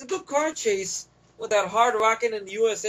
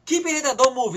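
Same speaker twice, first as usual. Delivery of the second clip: raised, firm retold order; mock urgent command; 6 semitones higher, 4 dB louder, faster than usual. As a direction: imitate her ordering him to stay down.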